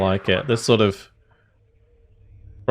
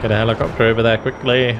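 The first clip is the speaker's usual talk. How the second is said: urgent command; 3 semitones higher, 5 dB louder, faster than usual. Real, not acted. Urgent order after bailout.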